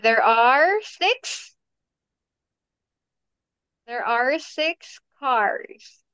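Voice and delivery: slow, careful teacher modelling. Shown as a demonstration. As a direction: spell it out slowly for a young learner. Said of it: "teacher models the full sentence".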